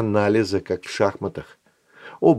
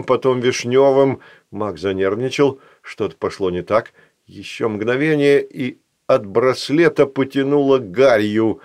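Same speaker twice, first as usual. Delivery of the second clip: usual speaking voice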